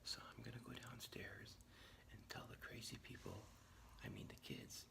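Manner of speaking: secret hushed whisper; whispering while hiding. Real, not acted. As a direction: keep it to a whisper. Sneaking down to surprise kids.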